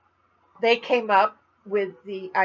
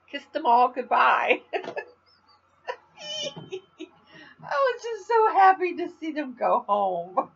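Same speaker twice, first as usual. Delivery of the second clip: high, laughing sarcasm; laughing sarcastically; 10 semitones higher, 5 dB louder than usual. Real, not acted. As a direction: laugh through the joke. Sarcastic joke about tourists.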